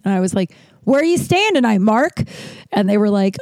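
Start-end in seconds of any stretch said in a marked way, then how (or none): 0.8-2.2 high-pitched, challenging question; acted-out indignant demand; pointed confrontation. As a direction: put the stranger on the spot, voice raised in pitch. Confronting the unwelcome stranger, angry.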